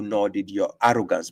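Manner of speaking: ordinary talk, nothing marked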